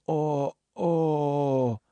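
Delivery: long mock moans of pain; imitated moaning. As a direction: moan dazedly, imitating an injured man. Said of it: "he's laying there moaning".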